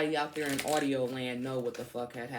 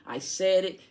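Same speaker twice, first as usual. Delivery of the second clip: defiant outburst; 4 semitones higher, 5 dB louder than usual. Real, not acted. Ranting at a commenter.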